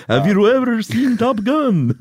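high, loud, slow hammed-up vampire drawl; Tom Cruise vampire impression. Co-host mocks Tom Cruise's vampire accent, hammed-up and high. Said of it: "I think he talked like this".

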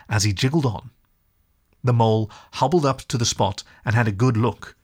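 usual speaking voice

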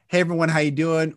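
brisk run-on greeting; quick cadence. Shown as a demonstration. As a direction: rattle the greeting off in one go.

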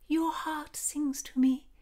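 high, slow and dreamy; lovestruck heroine. A narrator's reading. Heroine answers the lover carrying her, high and dreamy.

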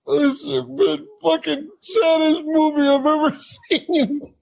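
high-pitched mock wailing through tears; hammed-up blubbering wail; bawling roadies. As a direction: imitate tough men sobbing out a complaint. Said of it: "then the roadies began to cry".

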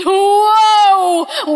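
loud, high startled "Whoa"; doctor yelling at the hairy patient, loud and high; doctor's startled yell. Retold or imitated. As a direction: blurt it out like a shocked doctor. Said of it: "the doctor screamed".

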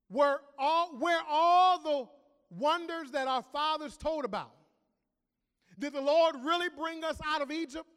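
mock Gideon's doubting whine; preacher mocking Gideon's silly complaint; whiny Gideon. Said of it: "Gideon's silly response".

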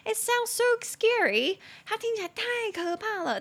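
high-pitched, hammed-up alarm; mock fright. Dramatizes fright at failed brakes.

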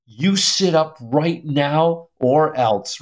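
slow, drawn-out warning, each word weighed; mock-threaten a child, very slowly; stern parental threat. Father ready to scold his son in church, stern.

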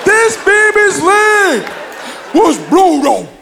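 put-on grandma defending her baby, gospel style. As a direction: belt it like a gospel singer. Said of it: "turned to [name]".

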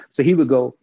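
ordinary talk

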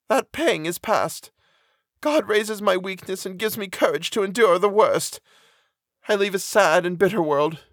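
high-pitched condemned woman, resigned to her fate; mournful. A narrator's reading. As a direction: speak high and earnestly, sadly accepting. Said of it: "shook her head mournfully".

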